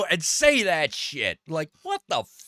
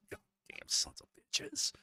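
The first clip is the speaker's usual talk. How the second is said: whispered curse, higher; muttered curse; 14 dB quieter, slower than usual. Retold or imitated. Cursing the smug guy under his breath, whispered. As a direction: hiss it under your breath.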